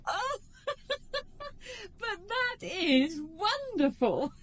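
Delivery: high, breathless laughing; laughing delight. Acted. Woman laughing in delight at his smuggler confession. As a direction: laugh through the words in delight.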